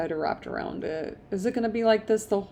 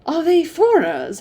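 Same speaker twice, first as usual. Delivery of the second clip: surprised question; 9 semitones higher, 7 dB louder than usual. A narrator's reading. Jane asks amazed.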